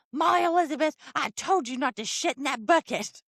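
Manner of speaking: high, loud mock mom scolding; angry mom scolding. Mother scolds her daughter by full name, high and loud. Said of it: "she was very mad at me".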